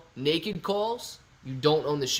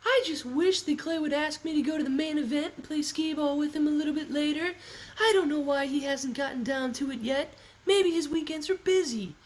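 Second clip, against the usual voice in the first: high put-on falsetto impression; Natalie; 11 semitones higher, 4 dB quieter than usual. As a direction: pitch it way up in a sustained falsetto. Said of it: "That's my Natalie voice".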